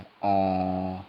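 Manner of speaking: "ओ" held long and rounded; teacher sounding a long "ओ"; long vowel demo. Shown as a demonstration. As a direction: draw out the "ओ". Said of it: "इसका थोड़ा लंबा उच्चारण होता है".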